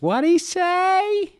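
very high, slow screechy joke voice; hard-of-hearing wife. Acted. Wife asking husband in the joke, hard-of-hearing screech. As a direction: screech it out, stretching each word. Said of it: "the wife is hard of hearing".

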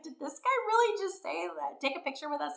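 stunned at what he said; incredulous. Retold or imitated. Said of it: "looking at each other like".

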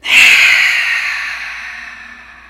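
biggest, loudest sigh of all; dramatic sigh. Shown as a demonstration. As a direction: push out a huge long sigh. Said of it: "One more with the biggest sigh".

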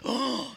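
audience's little shocked gasp; imitated gasp. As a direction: suck in a quick mock gasp. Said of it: "how they get that little gasp".

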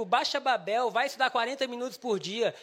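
ordinary talk

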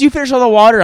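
high, loud imitation of her indignation; mock outraged yell. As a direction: yell it back in exaggerated disbelief. Retells her outraged question.

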